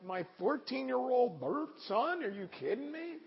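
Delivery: very high, slow astonished question; can't believe it about your own son; stunned disbelief. Retold or imitated. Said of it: "and I'm like".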